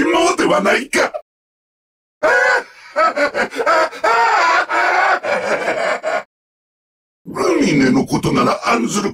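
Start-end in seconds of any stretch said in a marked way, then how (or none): 2.9-6.2 long high maniacal laugh; evil laugh. Acted. Villain enjoying the world's last moments, maniacal laugh. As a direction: laugh on and on, relishing destruction.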